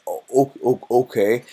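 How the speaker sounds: loud, stammering, caught off guard; stunned guy. Retold or imitated. Streamer acting the guy giving in, loud stammer. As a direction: stutter out an amazed okay. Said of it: "you're going".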